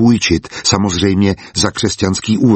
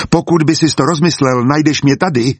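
understated call, pitched higher; calling after someone; 6 semitones higher than usual. A narrator's reading. Wolfram calls after the departing partner, a mild narrated shout. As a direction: call after him without really shouting. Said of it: "křikl za ním Wolfram".

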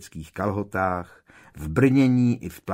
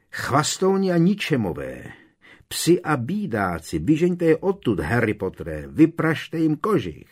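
high, rousing battle cries from a knight; povzbuzující křik; 6 semitones higher, 3 dB louder than usual. A narrator's reading. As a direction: cheer him on with high, grandiose insults. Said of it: "křikem ho povzbuzoval". (bojovné povzbuzování zvýšeným hlasem)

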